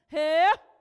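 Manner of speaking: a loud sharp closing shout; loud ritual cry. Real, not acted. Closing the prayer song with a loud ritual cry. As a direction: shout the cry to close the chant. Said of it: "Eu grito, vocês gritam".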